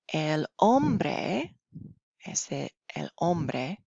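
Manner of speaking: slow, clear reading to learners; slow story reading. Acted. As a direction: read each phrase slowly for beginners. Reads story slowly to class.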